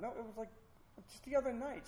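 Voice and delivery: high-pitched retold protest; defensive protest. Boyfriend disputing the fiancee's complaint, defensive protest. Acted quarrel, he denies the neglect.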